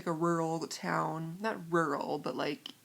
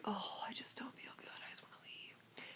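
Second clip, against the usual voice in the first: very soft, weary whisper; barely audible self-pitying whisper; whispered inner complaint; 2 semitones higher, 14 dB quieter than usual. Retold or imitated. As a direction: groan to yourself under your breath. Inner complaint while queuing.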